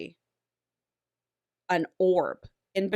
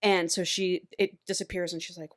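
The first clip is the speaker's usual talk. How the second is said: normal talking voice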